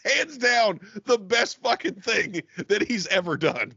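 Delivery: high, laughing gush of delight; excited gush. Retold or imitated. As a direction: squeal it out, thrilled. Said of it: "I was like".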